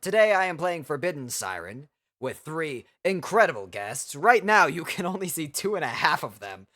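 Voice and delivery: lich lord welcoming his court; ancient rasping undead king. Acted. Streamer in Lich Lord persona.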